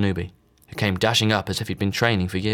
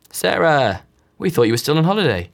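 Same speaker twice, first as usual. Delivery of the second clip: surprised greeting; 7 semitones higher, 5 dB louder than usual. A narrator's reading. Friends calling out in surprise.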